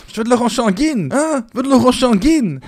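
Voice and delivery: high, loud rowdy reveller shout; rowdy carnival Gilles. Retold or imitated. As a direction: yell it like a carnival hooligan. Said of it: "en mode".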